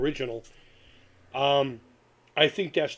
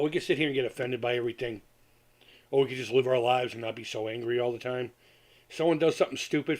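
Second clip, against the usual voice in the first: normal talking voice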